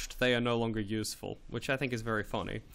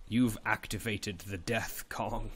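slow, low mock game announcer; hammed-up slow movie-trailer voice; dramatic announcer; 3 semitones lower, 4 dB quieter, slower than usual. Announces Death Kong dramatically.

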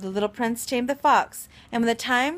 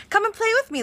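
put-on childlike high invitation; 13 semitones higher, 5 dB louder, faster than usual. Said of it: "the little prince proposed".

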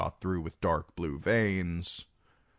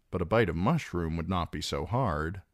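ordinary delivery

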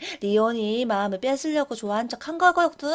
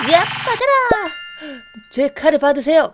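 battle cry; 2 semitones higher, 6 dB louder than usual. Acted. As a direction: hurl the attack with a yell. Attack in ghost class.

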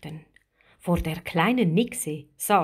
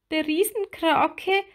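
high-voiced small character, awed; small, high voice in wonder; little mermaid, hesitant and high; timid little mermaid; 9 semitones higher than usual. A narrator's reading. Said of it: "sagte Lilli leise".

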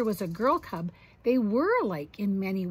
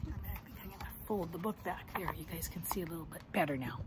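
quiet aside; 5 semitones lower, 11 dB quieter, faster than usual. Real, not acted. Aside while adjusting book.

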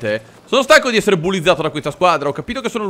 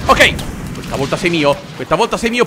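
usual speaking voice

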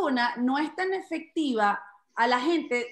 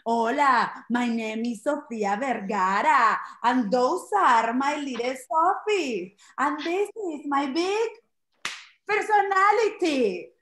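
Sofía Vergara; 4 dB louder, slower than usual. Acted. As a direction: draw it out proudly, building to the last word. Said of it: "pero ella es como".

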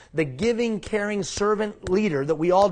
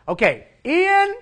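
much higher, louder, slow drawn-out mock; sarcastic okay; 14 semitones higher, 10 dB louder, slower than usual. Scene: viewer at home mocking the speaker, high drawn-out okay. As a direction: stretch out Ian in a high mocking voice. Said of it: "sitting at home afraid to say it".

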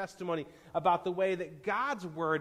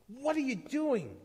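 high, nervous questioning cry; anxious raised-pitch demand; 8 semitones higher than usual. A narrator's reading. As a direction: call out nervously, pitched well up. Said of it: "one cried nervously".

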